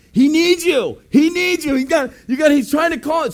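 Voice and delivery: high, loud, urgent call; urgent excited shout. Retold or imitated. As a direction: blurt it out, breathless with news. Friend urgently calls back.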